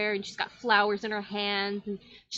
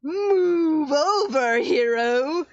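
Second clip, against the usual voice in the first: very slow, louder, higher bellowing creature; monster roar; 7 semitones higher, 8 dB louder, much slower than usual. Acted. Cow-headed millipede roaring at the hero, slow bellow. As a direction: bellow it like a giant beast. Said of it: "And it goes".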